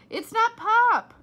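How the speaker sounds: loud, high, slow shout back. A narrator's reading. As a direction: yell it back down the hall. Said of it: "Flora shouted back".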